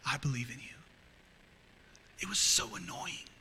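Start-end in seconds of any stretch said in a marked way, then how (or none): 0.0-0.8 tender inner voice. Retold or imitated. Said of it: "I'd always hear this voice".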